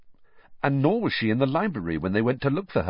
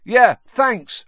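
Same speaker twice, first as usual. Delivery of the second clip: louder, tossed over the shoulder; shouted back; 12 semitones higher, 8 dB louder than usual. A narrator's reading. Ron shouting back to the fat lady as he runs, loud. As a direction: fling it back carelessly while hurrying off. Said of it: "Ron shouted over his shoulder".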